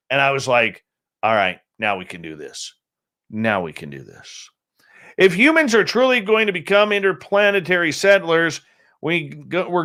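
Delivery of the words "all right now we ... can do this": low and soft, sinking further on the repeat; quietly composed. Retold or imitated. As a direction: retell settling yourself, hushed and low. Acting out himself, settled after nerves.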